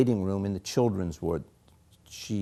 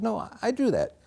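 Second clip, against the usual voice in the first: put-on insisting child; 11 semitones higher than usual. Boy insists to nurse.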